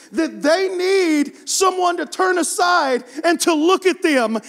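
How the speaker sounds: high, loud, stretched-out preacher cry; full-force preaching. Acted. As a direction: preach it out with passion, pleading for the hurting. Passionate preaching build-up.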